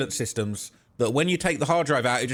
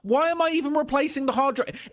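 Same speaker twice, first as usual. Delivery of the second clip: normal talking voice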